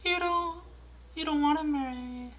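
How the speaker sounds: put-on light girlish falsetto; mock Jenny voice. Jenny turning Forrest down, soft falsetto. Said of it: "Now for Forrest Gump".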